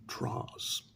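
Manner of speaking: plain reading voice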